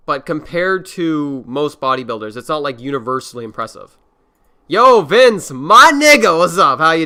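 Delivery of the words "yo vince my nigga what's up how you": big, high, loud hello to a friend; loud greeting. Real, not acted. Streamer hyping up his arriving guest, loud. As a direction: call out a hyped welcome.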